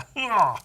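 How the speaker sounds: another quick laugh at himself; short laugh. Real, not acted. Laughing at own British bit.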